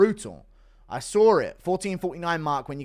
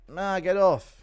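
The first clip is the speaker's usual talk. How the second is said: annoyed brush-off; 5 semitones higher, 5 dB louder, much slower than usual. Retold or imitated. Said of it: "impression of Maori holding you hostage by the arm".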